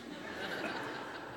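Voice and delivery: soft voiceless laugh at himself; relieved breathy laugh after finishing; breathless chuckle. Real, not acted. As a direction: let out a quiet airy laugh.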